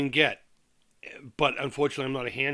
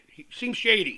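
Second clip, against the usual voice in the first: ordinary talk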